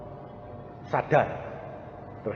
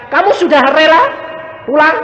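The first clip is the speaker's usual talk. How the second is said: higher, loud pastoral question; retold loud question; 12 dB louder than usual. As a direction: ask it boldly from the sickbed side. Preacher retells his own question. (pendeta bertanya lantang kepada orang sakit)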